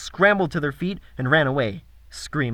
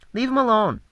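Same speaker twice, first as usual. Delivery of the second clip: put-on high commanding little girl; bossy girl voice; 8 semitones higher than usual. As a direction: order them to stop like a kid. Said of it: "she ordered".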